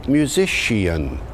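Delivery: musician said as myoo-ZISH-ee-an; musician with sh plus -ee-an ending; later Shakespearean pronunciation. Shown as a demonstration. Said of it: "Later in the period, it had evolved into".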